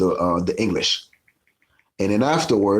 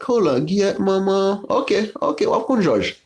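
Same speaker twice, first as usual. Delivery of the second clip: much higher hammed-up exclaiming; high mock voice; 12 semitones higher than usual. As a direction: squeak it out in a comic high voice. Haitians react, mock voice.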